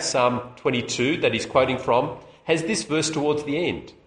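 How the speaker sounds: normal talking voice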